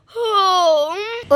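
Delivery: very long, held cow moo. Acted. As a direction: let out one drawn-out bellow. Cow bellows in the story. (गाय का लंबा रंभाना)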